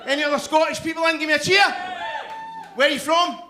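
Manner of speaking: fast, high, loud call-out to the room; rally the Scots in the room; shouted crowd call. Real, not acted. Calling out to the audience.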